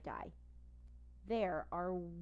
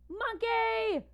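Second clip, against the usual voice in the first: shrill high scream in a cat voice; 10 dB louder than usual. A narrator's reading. Orange cat panics at the giant ape, screaming. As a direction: shriek in terror. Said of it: "screams the orange cat".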